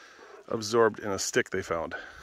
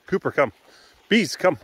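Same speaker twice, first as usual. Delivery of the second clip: raised, louder command to dogs; calling out; 8 semitones higher, 8 dB louder than usual. Real, not acted. Ordering each dog to come, calling out loudly. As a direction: call each dog by name, pitched up.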